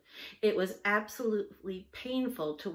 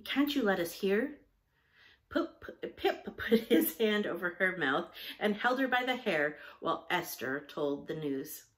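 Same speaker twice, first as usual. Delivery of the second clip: plain reading voice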